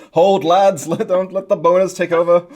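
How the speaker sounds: a loud rallying officer, played for laughs; rally the troops, hammed-up; mock battle command. Friend riffs on the hero as an officer, mock loud command.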